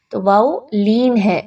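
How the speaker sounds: ordinary talk, nothing marked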